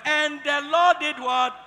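loud, drawn-out prompt to the congregation; leading call-and-response. Real, not acted. Preacher prompting the congregation to read together, loud call-and-response. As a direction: call it out slowly for all to join in.

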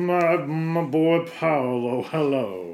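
hammed-up old master's hello; effusive Leonardo. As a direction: draw out a grand greeting to a dear boy. Leonardo greets Paolo in scene.

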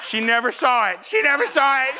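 high, loud comic outrage; exasperated. Retold or imitated. Husband tells crowd his effort went unseen, exasperated. Mock outrage in vacuum story.

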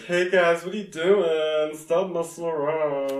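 put-on high, loud, slow whiny boy; mock whiny boy. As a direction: call out like an annoyed kid. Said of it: "and he's like".

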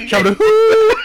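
high, loud, silly Sandler-style whoop; Adam Sandler yell. Retold or imitated. Imitating Adam Sandler's whoop for friends, loud and high. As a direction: belt it out like a goofy comic, laughing. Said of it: "Adam Sandler just goes".

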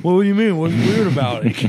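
loud, high playful protest; mock indignation. Man defending his cake gag to a date, loud mock indignation. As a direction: act innocent at full volume, laugh creeping in.

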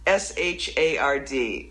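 S H A R D spelled letter by letter, slow. Shown as a demonstration. Poet spelling out a word she hates, letter by letter. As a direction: spell shard out slowly, letter by letter. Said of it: "it's like".

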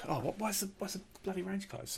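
high, quiet puzzled question; imitating a puzzled shooter. A shooter asking why the range closed, high and puzzled. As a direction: lightly voice a confused bloke. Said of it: "And someone goes".

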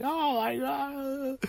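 high, soft, drawn-out mock sob; imitated bawling. Brother collapses crying on the grassy hill, imitated bawling. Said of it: "He's, he's bawling. He's like".

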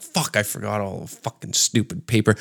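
low, quiet aside to oneself; muttered grumble. Retold or imitated. Comic to himself on getting a straw, muttered. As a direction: grumble it under the breath.

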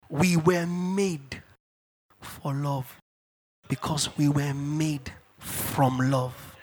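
very slow, low, measured statement; slow, solemn declaration. Real, not acted. Preacher teaching the congregation they were made for love, slow and deliberate. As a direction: let each phrase land, with long pauses.

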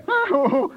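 a bright high giggle; high chuckle. Acted. Doc laughing at grumpy dwarf, high chuckle. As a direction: laugh it off lightly.

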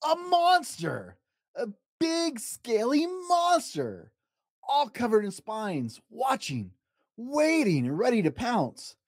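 high, slow, drawn-out build of dread; suspenseful build. A narrator's reading. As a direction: stretch each word like a creeping threat.

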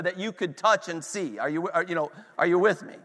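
ordinary delivery

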